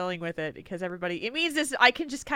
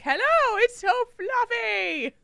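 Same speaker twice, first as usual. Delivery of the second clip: high, slow squeal of delight; mock swooning squeal; gushing fangirl; 13 semitones higher, 3 dB louder, slower than usual. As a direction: coo over fluffy hair. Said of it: "and it was like".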